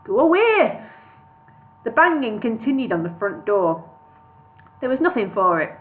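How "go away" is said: high, loud Brummie call; Birmingham accent. A narrator's reading. Bingo repeats his call at the door in Birmingham accent. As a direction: call it again after a pause, Brummie. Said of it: "he added".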